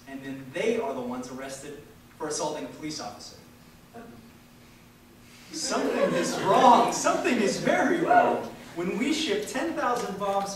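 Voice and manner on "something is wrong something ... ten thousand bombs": louder and higher, hammering the word very; rising outrage. Real, not acted. Speaker rousing the audience against injustice, loud indignant declaration. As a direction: build from firm to a near shout of outrage. Indignant climax of protest speech.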